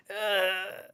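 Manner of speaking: high, strained croak of a ruined voice; hoarse damaged voice. Shown as a demonstration. Showing fans his lost voice, strained croak. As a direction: croak it out like your throat is shot. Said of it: "I was speaking like this".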